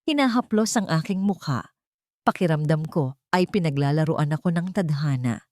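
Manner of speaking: ordinary delivery, nothing marked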